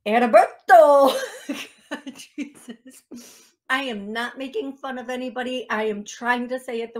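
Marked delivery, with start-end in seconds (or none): 0.1-1.2 rolled, exaggerated shout of Heriberto; dramatic Spanish accent. Name exclaimed with accent. 1.5-3.0 laughing. Real, not acted. Laughing reaction to name.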